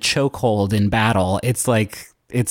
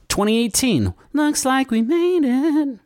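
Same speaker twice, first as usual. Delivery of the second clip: hyped announcer; 13 semitones higher than usual. Acted.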